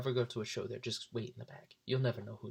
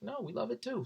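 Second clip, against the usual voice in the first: bright raised voice, brushing off critics; mock cheerful reply; 12 semitones higher than usual. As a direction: toss it off, high and cheery. Band answering critics, mock voice.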